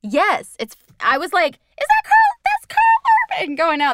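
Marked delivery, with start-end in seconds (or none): 1.5-3.5 very high, loud mock squeal; astonished recognition. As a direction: re-enact a star-spotting in a loud squeaky pitch. Retells own surprised recognition of actor.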